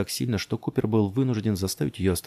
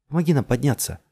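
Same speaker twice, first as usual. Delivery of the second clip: raised, higher voice; urgent plea; 6 semitones higher, 5 dB louder than usual. A narrator's reading. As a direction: call out urgently for help. Urgent plea to unresponsive man. (настойчиво, громко просит)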